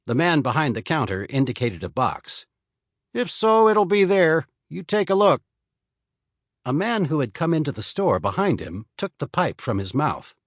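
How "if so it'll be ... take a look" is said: storekeeper. Acted. Storekeeper voiced in story.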